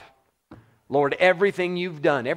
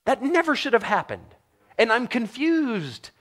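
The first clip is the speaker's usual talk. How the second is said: baffled self-reproach; 7 semitones higher than usual. Retold or imitated. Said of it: "I look back and go".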